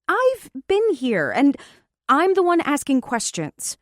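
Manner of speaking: high, loud indignant demand. Acted. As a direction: push back, affronted. Demanding answers angrily.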